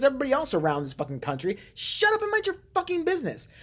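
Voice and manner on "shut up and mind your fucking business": much higher-pitched, exasperated telling-off; exasperated outburst. Real, not acted. Ranting at a woman blocking the door, exasperated. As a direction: tell them off, pitch jumping high. Rant at a nosy neighbor.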